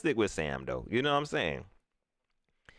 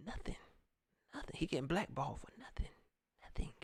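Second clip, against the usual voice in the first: hushed whisper, almost under the breath; soft voiceless aside; 13 dB quieter, faster than usual. Real, not acted. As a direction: whisper it to yourself, bitter. Muttering about Cam being blackballed.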